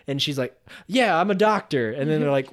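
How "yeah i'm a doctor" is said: loud, higher-pitched mock confidence; overdone eager agreement; acted bluff. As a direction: bluff your way through it. Acts bluffing to cop.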